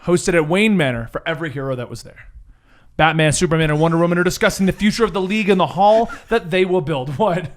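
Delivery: ordinary delivery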